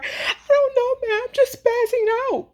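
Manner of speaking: high, sobbing imitation of a crying person; mock crying. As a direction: wail it like someone crying uncontrollably. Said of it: "a person is crying uncontrollably".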